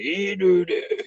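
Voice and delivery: lightly mock the cowboy filter voice; imitating Poon Daddy voice. Mocking Leno's character to chat, lightly voiced cowboy. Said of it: "He does this voice".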